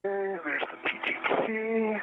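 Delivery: crackly distant radio transmission; breaking up space radio. Real, not acted. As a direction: sound like a garbled helmet radio.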